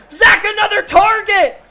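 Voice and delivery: high, loud taunting yell; laughing, yelling at a friend about targets; mocking shout. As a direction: razz your buddy loudly. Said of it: "I kept laughing, going".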